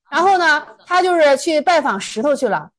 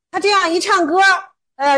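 ordinary talk, nothing marked

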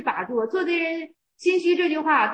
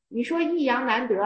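ordinary talk

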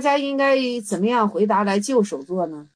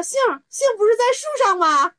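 mock teacher voice; 11 semitones higher than usual.

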